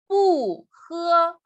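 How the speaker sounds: "bu" falling from high, "he" high and level; original fourth tone on bu. Shown as a demonstration. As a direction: drop "bu", then hold "he" high. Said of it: "mantiene su tono original".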